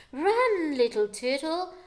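sung warning; urgent sing-song call. A narrator's reading. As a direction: call out to warn him. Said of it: "sang the sea".

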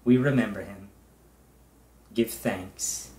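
measured prayer voice with long pauses between phrases; leading prayer. Real, not acted. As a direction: pastor leading the congregation in prayer. Pastor leads congregational prayer.

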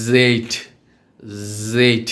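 "জেড" with a long voiced Z buzz; tongue forward, sustain the Z in "জেড"; slow, stretched "জেড", buzzing onset; buzzed letter Z. Shown as a demonstration. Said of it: "জি এর মতো".

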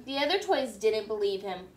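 ordinary delivery, nothing marked

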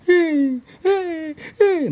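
high, loud mock wail. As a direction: wail like a panicked boy. Boy crying over crashed car. (gémissement moqueur, aigu et fort)

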